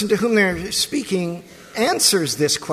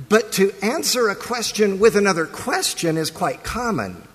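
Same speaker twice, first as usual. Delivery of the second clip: normal talking voice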